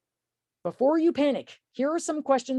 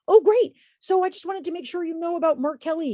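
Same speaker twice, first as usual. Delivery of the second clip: bright, eager phone greeting, much higher and louder at the start; smiling voice; 8 semitones higher, 5 dB louder than usual. Shown as a demonstration. Volunteer calling voter Susan, high, loud smiling friendliness. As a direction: beam through the phone so she stays on the line. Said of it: "talking with a smile on your face".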